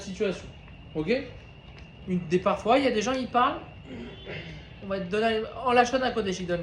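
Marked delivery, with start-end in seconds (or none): none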